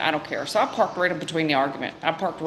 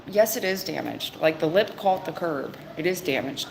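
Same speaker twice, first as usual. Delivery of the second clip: ordinary talk, nothing marked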